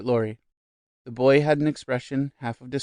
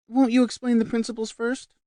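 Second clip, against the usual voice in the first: lightly voiced; 11 semitones higher, 3 dB louder than usual. A narrator's reading. Maggie asks.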